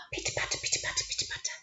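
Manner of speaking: quick, breathy whispered patter of little feet; a high secret whisper; pitter patter whisper. Shown as a demonstration. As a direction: whisper it like tiny scampering feet. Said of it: "That's how they move".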